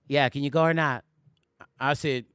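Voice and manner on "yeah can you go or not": lower-pitched imitation of a friend; lightly imitated caller pressing for an answer; a hint of a low-voiced, insistent friend; Amir. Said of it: "This is how Amir sounds".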